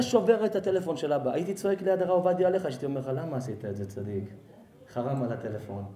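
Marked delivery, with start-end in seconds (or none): 3.1-5.8 low, soft acted reproach; restrained reproach. Chiding the boy beside the revered rabbi, restrained low voice. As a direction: hold back from shouting, keep it down. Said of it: "הייתי מתבייש לצעוק".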